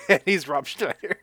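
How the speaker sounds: amused laughing. Real, not acted. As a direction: laugh at the absurdity as you say it. Amused by the casting irony.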